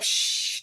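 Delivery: imitated shush. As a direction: hush someone with a voiceless shh. Imitates godson shushing her.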